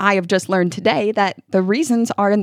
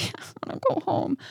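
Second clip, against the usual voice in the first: high, hushed mock-tearful whimper; imitating sobbing child; 10 semitones higher, 9 dB quieter than usual. Overwhelmed daughter asking parent to go home, imitated tearfulness. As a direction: squeak it out softly like a crying child. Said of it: "my daughter just starts sobbing".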